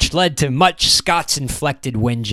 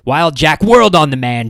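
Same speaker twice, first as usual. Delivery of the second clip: ordinary delivery, nothing marked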